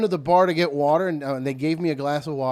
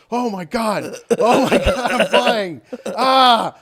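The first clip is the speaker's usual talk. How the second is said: mock thrill; 7 semitones higher, 9 dB louder, slower than usual. Retold thrill while flying.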